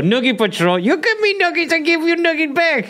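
squeaky high playful voice; high silly voice. Pretend.

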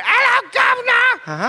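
high, slow, laughing mock cockney greeting; bad British accent. Cohost mocking Flynn's fake accent to the guys, laughing. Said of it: "There he is right there".

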